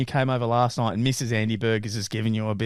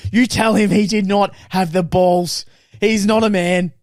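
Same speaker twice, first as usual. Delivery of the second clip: hammed-up angry rant, louder and higher; passionate outburst; 8 semitones higher, 5 dB louder than usual. Anchor angrily urges his reporter to confront the witness. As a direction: bark it like a furious anchor. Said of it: "I'm passionate about this".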